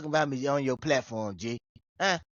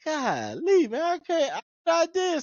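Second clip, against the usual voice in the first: mock despair; 14 semitones higher, 3 dB louder, slower than usual. Mock despair after scolding.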